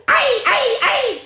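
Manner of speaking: very high repeated yelps; silly sounds. A narrator's reading. Reader forced into silly sounds, high yelping. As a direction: squeak each one out sharply. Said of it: "make silly sounds like".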